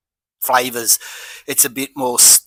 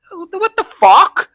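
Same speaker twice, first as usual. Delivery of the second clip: high, slow incredulous squeal; can't believe what he just saw; stretched-out what the fuck, pitched way up; outraged disbelief; 14 semitones higher, 3 dB louder, slower than usual. Retold or imitated. Outraged at queue jumper.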